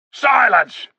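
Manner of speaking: high, loud barked order; thundering command. A narrator's reading. Tarkan silencing the crowd with a thundering shout. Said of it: "thundered Rishta Tarkan".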